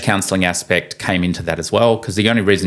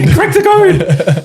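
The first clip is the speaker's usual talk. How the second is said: delighted echo; 7 dB louder, slower than usual. Real, not acted. Echoing listener, laughing.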